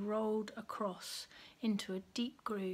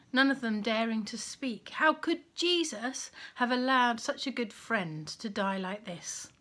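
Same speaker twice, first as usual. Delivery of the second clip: ordinary delivery, nothing marked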